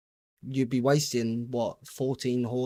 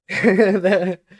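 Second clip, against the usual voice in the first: a loud burst of real laughter; 6 semitones higher, 11 dB louder than usual. Real, not acted. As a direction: break out laughing, loud and high. Laughs at chat.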